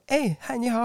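high, bubbly "hi, hello"; eager team greeting. Retold or imitated. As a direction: gush a welcome like an overfriendly coworker. Team members greet warmly. (高声热情地问好)

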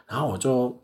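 ordinary talk

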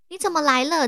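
high, startled "what are you doing here"; a startled teacher greeting a student; surprised professor. Retold or imitated. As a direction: play the professor caught off guard. Professor voiced in story.

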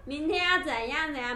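loud, high, drawn-out singsong call; calling for attention. Retold or imitated. Class monitor to classmates who won't listen, calling out loudly. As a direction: imitate a class monitor rallying the room.

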